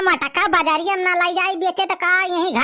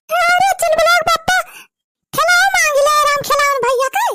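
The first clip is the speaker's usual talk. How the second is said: shrill village wife; 8 semitones higher, 3 dB louder than usual. Acted. Wife character shouting to husband.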